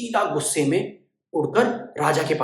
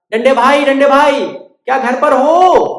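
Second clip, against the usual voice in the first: high, loud bird calling; 9 semitones higher, 10 dB louder than usual. Acted. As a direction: call to the mouse at his door.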